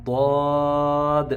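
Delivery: ضاد slow and full-mouthed, heavy; heavy daad. Shown as a demonstration. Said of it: "sound جو ہے وہ موٹی".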